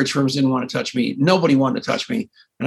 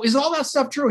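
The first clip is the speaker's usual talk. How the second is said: pitched way up and hopeful; high eager question; 10 semitones higher than usual. Retold or imitated. Caller guessing the catch on the phone, pitched high with eagerness. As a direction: ask like everything hangs on it. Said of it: "I'm crazy".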